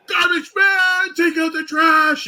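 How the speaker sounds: high, slow, loud chanting yell; drunk fan shouting. Retold or imitated. Drunk fan shouting at the wrestler on deck, slow and loud. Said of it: "He's like".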